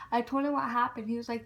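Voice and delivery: normal talking voice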